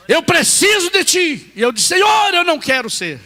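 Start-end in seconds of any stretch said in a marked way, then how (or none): 0.1-1.5 slow, loud, high commanding call; voice of God. Retold or imitated. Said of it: "Olha os detalhes quando Deus fala". 1.9-3.2 refusing cry. Retold or imitated. Refusing God's call, retold.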